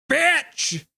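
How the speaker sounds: high, loud, spat-out yell; shouted insult. Real, not acted. Streamer yelling at opposing player after dirty hit, furious shout. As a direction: fling the word at him full force.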